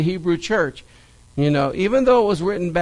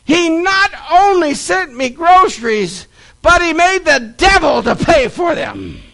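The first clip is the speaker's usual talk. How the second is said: high, loud, exultant shouting; overjoyed lady crowing with thanks; jubilant praise; 11 semitones higher, 7 dB louder than usual. Retold or imitated. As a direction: celebrate wildly as if jumping and clapping. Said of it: "jumping up and down and clapping her hands".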